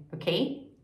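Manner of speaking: ordinary delivery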